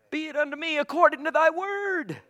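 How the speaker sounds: high, ringing proclamation; fervent declaration. Real, not acted. Repeats Mary's words as fervent declaration.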